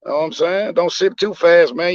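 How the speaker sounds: usual speaking voice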